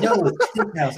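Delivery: high, loud laughing no; laughing mock insistence. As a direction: laugh out the in-laws' reply. Voicing in-laws overruling him.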